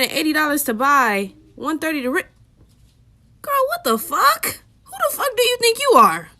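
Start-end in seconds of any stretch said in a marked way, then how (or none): 3.4-6.3 outraged disbelief. Real, not acted. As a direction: shriek it at the price in disbelief. Outraged at textbook price.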